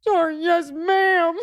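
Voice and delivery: put-on high, wailing little-boy voice; imitating crying child. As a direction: blurt it like a flustered, tearful child. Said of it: "he's crying".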